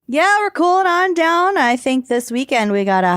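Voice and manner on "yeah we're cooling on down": high, bright TV forecaster voice; playful weatherman delivery; weather person voice. Acted. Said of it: "I sound like a weather person".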